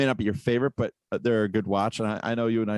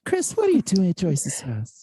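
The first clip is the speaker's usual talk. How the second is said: high, slow mock scolding; exaggerated high-pitched parental questioning; put-on mother; 8 semitones higher than usual. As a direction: call out like a nosy mom. Acting a mother calling.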